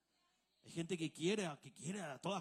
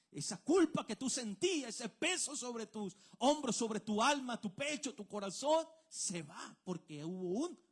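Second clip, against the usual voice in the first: higher, louder preaching on guilt and burden; full-force preaching; 6 semitones higher, 7 dB louder than usual. Acted. Pastor preaching to the congregation about guilt, loud and high. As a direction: lift the congregation with a swelling call.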